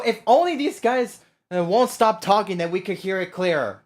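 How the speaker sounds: normal talking voice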